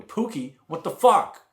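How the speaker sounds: shocked exclamation. Retold or imitated. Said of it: "I put my hand on her chest and I go".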